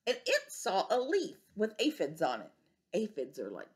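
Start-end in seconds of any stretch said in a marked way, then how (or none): 2.9-3.7 confiding whisper to the class; whispered aside. Real, not acted. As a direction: lean in and whisper the explanation. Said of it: "aside explaining to kids".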